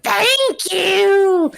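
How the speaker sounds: very high, loud, laughing; squeaky grateful imp. Acted. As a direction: squeal it like a happy little imp. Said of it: "And he's like".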